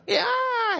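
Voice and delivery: high gleeful scream of riders; imitated scream. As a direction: shriek like riders going down. People screaming down the slide.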